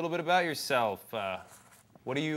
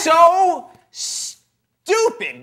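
high, loud, slow exasperated yell; stretched-out angry yell; furious outburst; 12 semitones higher, 8 dB louder, much slower than usual. Acted. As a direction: let the scorn explode. Judge bursts out in scorn.

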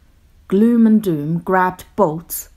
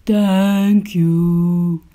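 slow, loud monster boom; 6 dB louder than usual. A narrator's reading. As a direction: boom it out slowly like a giant. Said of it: "booming voice".

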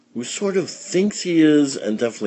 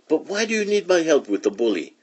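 put-on kitten boy voice; 9 semitones higher, faster than usual. A narrator's reading. Skippy asks chihuahuas.

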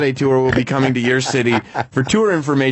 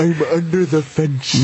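slow silly voice; slower than usual. Pretend. Voicing the horse in photos.

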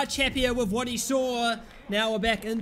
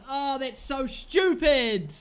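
ordinary talk, nothing marked

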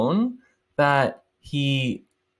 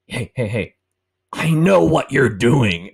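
imitating a busybody who thinks he caught them, louder at the end; mock-stern, slow accusation; confronting busybody; 5 dB louder than usual. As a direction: catch them in the act, slow and pointed. Man confronting the cheating couple.

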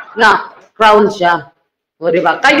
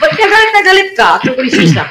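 much higher, sing-song taunt; mock taunt; 5 semitones higher, faster than usual. Host taunting the basher, mock sing-song. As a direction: needle him about his anger.

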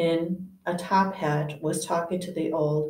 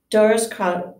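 usual speaking voice

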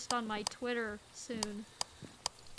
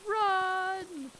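panicked shout; 6 semitones higher, 4 dB louder than usual. Real, not acted. Fleeing in panic from ghost.

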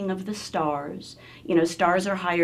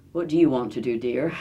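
lower-voiced speech teacher; 5 semitones lower than usual. Speech teacher asks her student's ambition, teacher role. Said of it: "had a fabulous speech teacher".

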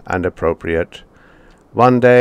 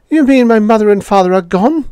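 put-on high, louder boy's voice in disbelief; dismayed boy; 13 semitones higher, 7 dB louder, faster than usual. A narrator's reading. Boy realising his parents are gone, high and dismayed. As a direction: ask it like a boy who can't believe it.

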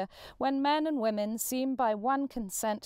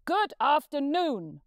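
louder, higher, slow and emphatic; final curt dismissal; 5 semitones higher, 5 dB louder, slower than usual. Acted. Scrooge ending the talk with his nephew, loud and slow. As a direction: shut the door on him with each word.

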